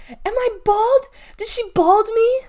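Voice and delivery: very high, loud panicked cries; screaming. Acted. Galveston screams to her mom about her scalp, panicked.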